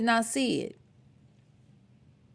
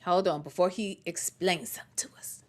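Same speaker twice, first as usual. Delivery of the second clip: whisper; 4 semitones lower, 9 dB quieter than usual. Real, not acted. Aside while pausing the clip.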